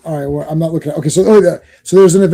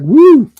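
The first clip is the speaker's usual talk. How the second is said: high, loud mock "woo"; startled whoop; 10 semitones higher, 7 dB louder than usual. Comic fan acts out Jarvis pounced on by Tigra, startled whoop. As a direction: whoop as if jumped on by surprise.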